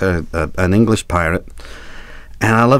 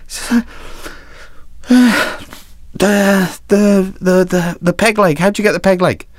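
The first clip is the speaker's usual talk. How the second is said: high, hesitant stammering; nervous young reporter; 11 semitones higher than usual. Acted. Young reporter nervously asking the pirate about his peg leg, high and hesitant. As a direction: stammer the question up high and timid.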